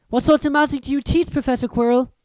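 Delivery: put-on a shy boy remembering. Narrator voices Harry.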